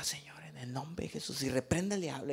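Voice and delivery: hushed whispered rebuke of the devil; quiet desperate whispered prayer. Retold or imitated. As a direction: pray it under your breath. Praying under breath at airport.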